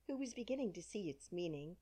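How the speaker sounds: plain reading voice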